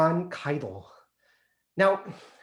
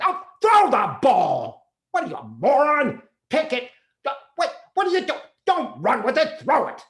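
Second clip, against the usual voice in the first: loud, exasperated high yelling at the game; father yelling at TV; 10 semitones higher, 7 dB louder than usual. Retold or imitated. Father watching football yells at the TV players, loud and angry. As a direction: bellow at the players on the screen.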